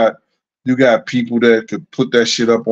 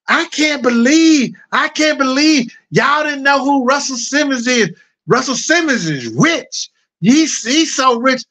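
flamboyant guys, pitched way up and slowed; mock zesty voice; 12 semitones higher than usual. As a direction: guys on the stairs gushing about Russell, mock zesty voice. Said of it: "they would start talking like that".

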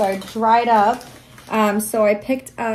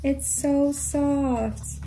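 cooing; 5 semitones higher, 5 dB quieter, much slower than usual. Real, not acted. Cooing over blanket.

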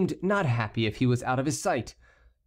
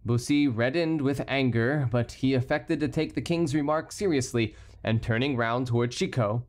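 plain reading voice

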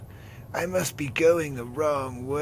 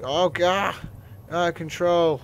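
higher, louder alarm; 6 semitones higher, 5 dB louder than usual. Real, not acted. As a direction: panic as it spins. Ship spinning out.

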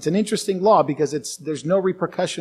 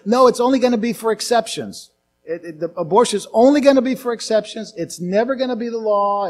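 raised, louder mock promise; higher, emphatic placating; insistent assurance; 6 semitones higher, 5 dB louder than usual. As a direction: imitate someone overselling a reassurance. Acting out false assurances to church.

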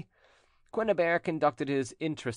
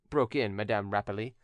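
usual speaking voice

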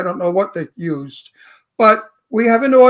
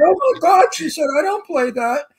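higher, offended actress gasp; upset actress; 7 semitones higher than usual. Retold or imitated. Retelling the actress's reaction, mock offended and higher. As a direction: voice her shocked and offended.

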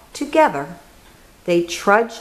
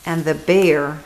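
plain reading voice

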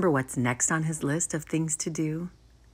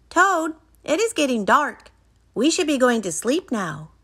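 high-pitched little Frog voice; 7 semitones higher, 3 dB louder than usual. A narrator's reading. Frog telling Toad it's late, high-pitched. Reads Frog's line in story.

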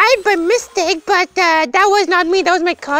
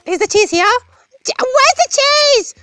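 high-pitched, playful demand for cheese; greedy cheese craving; 9 semitones higher than usual. Pretend. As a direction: beg like a cheese-crazed mouse.

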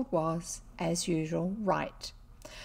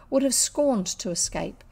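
ordinary delivery, nothing marked

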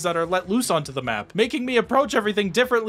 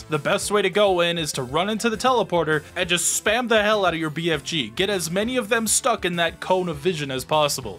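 normal talking voice

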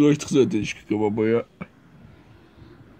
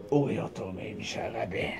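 whispering; 6 semitones lower, 10 dB quieter than usual. Acted. Whispered aside.

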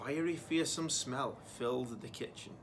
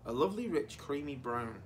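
ordinary delivery, nothing marked

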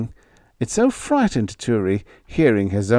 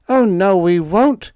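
high and louder in sudden panic; panicked refusal; 10 semitones higher, 6 dB louder than usual. A narrator's reading. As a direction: blurt it out in a panic. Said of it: "In a panic".

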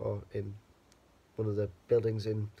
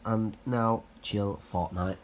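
usual speaking voice

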